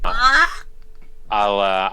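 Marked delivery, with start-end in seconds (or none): 0.1-0.5 loud high squawk; raven caw. Acted. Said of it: "It goes".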